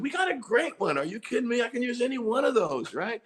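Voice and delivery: high-pitched, enthusiastic reassurance; encouraging producer. Retold or imitated. Producer answering the reluctant singer, high and enthusiastic encouragement. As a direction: play an excited producer talking up the takes. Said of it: "then he starts encouraging you".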